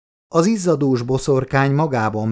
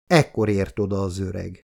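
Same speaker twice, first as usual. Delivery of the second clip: usual speaking voice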